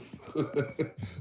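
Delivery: airy chuckle; quick wheeze; laughing at own quip; breathy laugh. Real, not acted. Laughs after joke.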